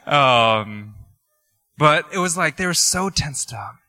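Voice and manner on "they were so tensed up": ordinary delivery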